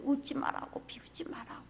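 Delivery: tearful plea. Retold or imitated. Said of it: "막 울면서".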